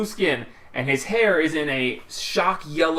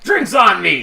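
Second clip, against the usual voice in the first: hearty call; 6 semitones higher, 8 dB louder than usual. Acted. Wrestler announces drinks.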